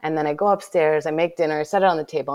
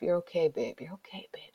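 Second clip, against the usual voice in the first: soft, near-whispered reassurance; gentle self-soothing; 9 dB quieter than usual. Retold or imitated. Kneeling in the snow, soothing herself in a soft hush. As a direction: soothe yourself tenderly, barely voiced. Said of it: "talking so gently to myself".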